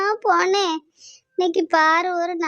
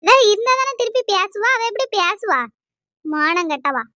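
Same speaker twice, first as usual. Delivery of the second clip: angry outburst; 2 semitones higher, 3 dB louder than usual. Acted. Angry public quarrel.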